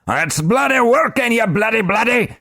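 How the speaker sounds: high, loud sputtering rage; angry little man. Acted. Globin raging at Korash, high sputtering shout. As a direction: splutter out the curses.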